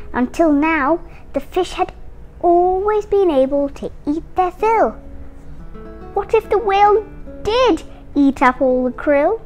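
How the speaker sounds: plain reading voice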